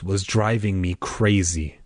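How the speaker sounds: plain reading voice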